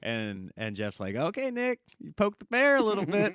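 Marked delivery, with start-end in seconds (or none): 1.2-3.3 higher, teasing told-you-so; mock-scold him, pitched up; amused warning. Jeff warning Nick he poked the bear, high and teasing.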